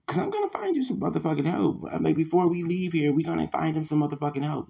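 loud, high-pitched, fast outburst; heated rant. Real, not acted. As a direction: fire it off loudly, worked up and insistent. Heated rant, threatening to find help.

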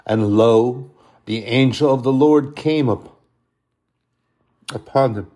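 solemn Bible reading, lifted and loud; scripture reading. Acted. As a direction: proclaim the verse to the congregation. Reads scripture aloud, raised voice.

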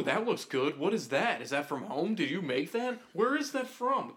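pry loudly into someone's lunch; nosy coworker. Retold or imitated. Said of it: "they're like".

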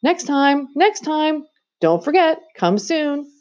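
put-on high called farewell to a boy far away; calling out. A narrator's reading. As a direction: call after him from a moving sledge. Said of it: "calling out".

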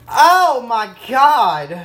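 loud, high "oh" then falling; delighted exclaim. Real, not acted. Tasting his finished sandwich, loud delighted gush.

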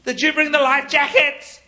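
frantic shout about the life jackets; panicked disciple. Retold or imitated. Disciple panicking at Peter in the storm, frantic. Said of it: "They're like".

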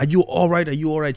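high, alarmed questioning; freaking out. Retold or imitated. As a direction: imitate a frantic grandma checking on someone. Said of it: "She was freaking out".

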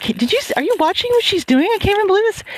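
high-pitched retold disbelief; appalled. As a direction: urgently nudge a friend in mock outrage. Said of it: "I was just appalled".

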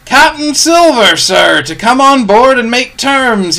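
high, loud hail, slowing towards the end; shouted announcement. A narrator's reading. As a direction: shout the message up to the fort. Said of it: "he shouted".

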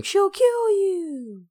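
very high, drawn-out falsetto cry; mock screaming. Imitating Dustin's warning to the bullies, mock screaming. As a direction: imitate a kid's screamed warning, stretched out slow. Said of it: "Dustin kept screaming".

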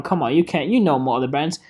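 ordinary talk

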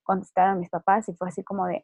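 ordinary delivery

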